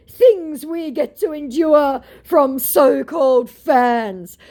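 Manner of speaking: high, slow, put-upon whine; mock scammer voice. Mocking the scammer who complains about fans, high and slow. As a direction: play the scammer as wounded and precious. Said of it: "said my scammer".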